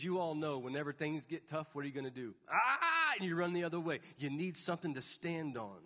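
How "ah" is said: high, loud comic "ah" of panic; mock panicked scream. Preacher mimes running away when things get tough, mock scream. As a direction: yelp as if fleeing in pretend terror.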